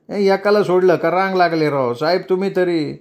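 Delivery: a lightly voiced, fussing hospital ward boy; grumbling ward boy. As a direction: grumble about the queue.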